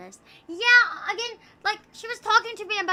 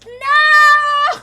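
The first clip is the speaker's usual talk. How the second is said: very high, loud "No!"; shrill outraged shout; 12 semitones higher, 15 dB louder than usual. Real, not acted. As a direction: yell "No!" at chat. Denial shout.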